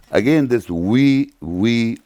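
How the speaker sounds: "we" drawn out and pressed, repeated; stress on we. Shown as a demonstration. Lecturer to audience, pressing on "we" slowly. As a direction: lean hard on "we", slowly. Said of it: "we, I'm emphasizing".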